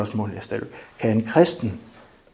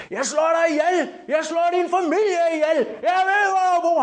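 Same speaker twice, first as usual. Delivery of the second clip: furious yell. Retold or imitated. Angry opponent screaming threats at the young defender. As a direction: scream death threats at him. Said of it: "råber og skriger af mig".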